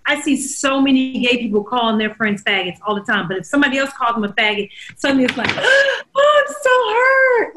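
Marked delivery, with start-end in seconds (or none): 5.5-7.6 high-pitched, drawn-out mock wail of hurt. Host mocking people offended by a word, mock hurt.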